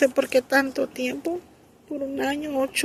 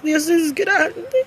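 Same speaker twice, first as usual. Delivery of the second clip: slow, tearful praise through real crying; 5 semitones higher, much slower than usual. Real, not acted. Mother testifying about her son, crying through her praise. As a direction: let the words break as you weep.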